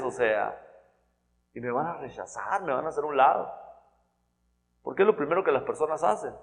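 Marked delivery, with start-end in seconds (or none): none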